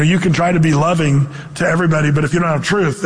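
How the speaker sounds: normal talking voice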